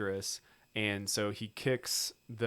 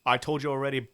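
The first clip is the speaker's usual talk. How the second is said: put-on Cockney accent; 4 semitones higher, 6 dB louder, faster than usual. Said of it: "says to him, like".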